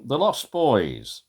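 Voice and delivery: usual speaking voice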